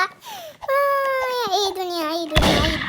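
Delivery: hammed-up sobbing song line; fake-cry the words; mock wail. Gamer hamming up a tearful Eid song, mock wail.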